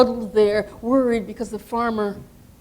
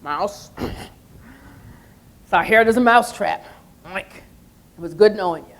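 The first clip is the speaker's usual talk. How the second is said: pig voice. Acted. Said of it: "the pig came along".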